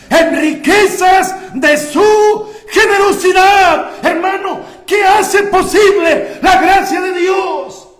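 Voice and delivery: very loud, high and drawn out; full-force preaching shout. Real, not acted. Preacher proclaims the verse to his congregation, shouting. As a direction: thunder each phrase at the congregation.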